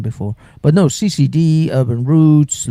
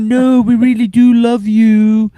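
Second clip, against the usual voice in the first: slow, high, louder sneering mock praise; sarcastic mock compliment; 7 semitones higher, 4 dB louder than usual. Troll in the chat mocking the host with fake love. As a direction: ham up a fake compliment that turns to insults. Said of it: "It's like".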